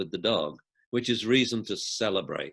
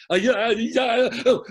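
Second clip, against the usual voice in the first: flustered on the phone; 12 semitones higher, 7 dB louder, slower than usual. Retold or imitated. Imitating himself on phone.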